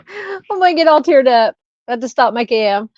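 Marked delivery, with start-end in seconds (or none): 0.1-1.6 a laugh breaks in, pitch jumps higher; touched niece's visit makes her laugh-cry; laughing, teary-eyed. Real, not acted. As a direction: laugh through the tears coming on. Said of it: "Now I wanna cry".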